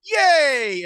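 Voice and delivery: high squealing cheer; excited yay. Retold or imitated. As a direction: squeal it out with delight. Mocking excited reaction.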